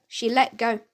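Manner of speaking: usual speaking voice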